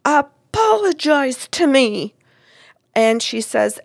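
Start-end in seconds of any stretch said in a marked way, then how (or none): 0.0-2.1 mock scream, higher and drawn out; retold scream. Client recalls screaming at her in her house, mock scream. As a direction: imitate a demanding yell, slowly, without full volume. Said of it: "I was screaming at her in my house".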